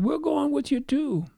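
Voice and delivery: high, eager answer; eager reply. Retold or imitated. Disciples telling Peter they'll come, high and eager.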